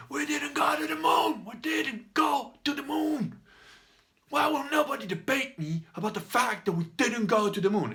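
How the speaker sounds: high, full-voiced hammed-up shouting; shouty man. Shouty man challenging the beer hall about the moon, shouting. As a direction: bellow it like a beer-hall orator, stretched and strained. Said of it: "the shouty man at one point goes".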